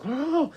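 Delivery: high, strangled gasp for air; desperate gasp. Retold or imitated. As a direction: suck in air after nearly drowning. Man finally let up from water.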